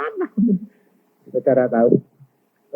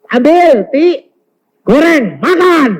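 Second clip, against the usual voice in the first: high, loud wifely command; imitated wife, raised voice; wife giving orders; 12 semitones higher, 8 dB louder, slower than usual. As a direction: call each order out loudly to the maid. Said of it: "Lalu istri bilang".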